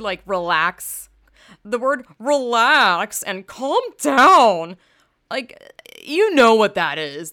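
building indignant rant; furious emphasis on "relax" and "calm down"; heated rant. Real, not acted. Ranting about "relax", "calm down.

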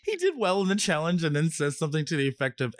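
ordinary talk

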